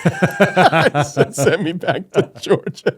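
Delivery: high, slow punchline through laughter; amused, laughing imitation; laughing retold quote. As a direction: crack up while quoting the kid.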